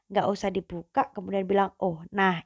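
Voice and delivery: normal talking voice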